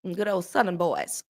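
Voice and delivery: normal talking voice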